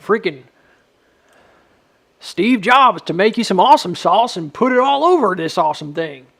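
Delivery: loud, high hype-man gushing; over-the-top hype; hype-man praise. Acted. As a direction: pump him up like a hype man. Hyping himself up.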